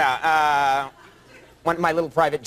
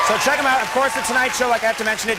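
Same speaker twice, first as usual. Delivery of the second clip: higher, projected announcer delivery; host's plug; 6 semitones higher than usual. Acted. Host plugging guest's show at segment's end, announcing. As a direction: push the show plug out to the audience.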